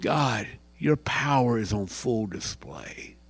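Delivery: low, soft, reverent praise; hushed prayer. Pretend. The right way at sunset, a soft praise to God. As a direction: speak it quietly to God, in awe.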